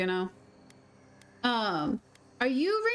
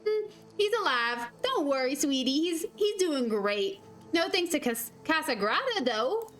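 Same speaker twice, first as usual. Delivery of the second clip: put-on sweet Midwestern accent, slow. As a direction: reassure in a folksy Midwest voice. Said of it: "I grew up around this accent".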